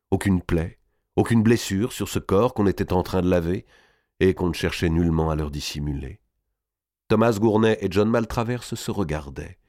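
ordinary delivery

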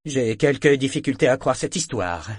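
loud, pointed irony; ironic. A narrator's reading. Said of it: "d'un ton ironique".

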